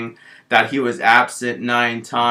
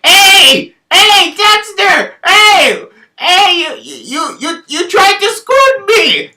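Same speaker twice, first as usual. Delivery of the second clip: very high, loud calling out, slower; yelling lunch lady; 9 dB louder, slower than usual. Retold or imitated. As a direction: shriek his name across the cafeteria.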